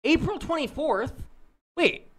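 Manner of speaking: high, incredulous question; suddenly high in disbelief. Real, not acted. Shocked at the age reveal.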